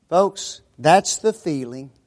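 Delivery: ordinary talk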